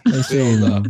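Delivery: low, mock robotic email reply; mock auto reply voice.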